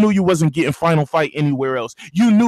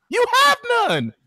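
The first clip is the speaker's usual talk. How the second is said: high-pitched taunt. Real, not acted. Host taunting fans who lack exclusives, shrill-pitched jeer. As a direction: pitch leaps way up on the repeat. Repeats point in high mocking outburst.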